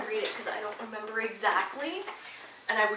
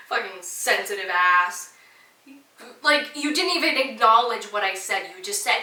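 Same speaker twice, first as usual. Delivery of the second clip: louder, real irritation rising; getting heated; 7 dB louder than usual. Real, not acted. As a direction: vent at her as if she were here. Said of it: "I'm even getting heated about it now".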